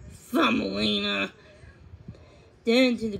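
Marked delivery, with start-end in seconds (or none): none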